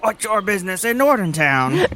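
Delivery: ordinary talk, nothing marked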